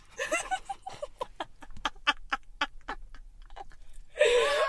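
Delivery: long high wheezing laugh; laughing to tears. Real, not acted. Co-hosts losing the no-laughing game, helpless laughter. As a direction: laugh helplessly until out of air. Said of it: "I've actually got tears".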